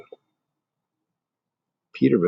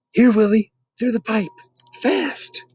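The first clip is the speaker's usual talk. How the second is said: much higher, urgent call; 12 semitones higher, 4 dB louder than usual. Acted. Archie calling Willie through the pipe, urgent.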